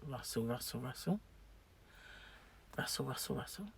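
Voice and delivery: hushed, fading rustle sound; very soft, low repeated rustling; imitated rustling. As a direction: murmur it like a faint noise in the dark. Imitating rustling heard in bed.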